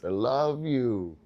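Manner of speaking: slow, drawn-out mock-tender coo; exaggeratedly slow affection; talking to parents. Shown as a demonstration. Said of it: "that's how you talk to your parents".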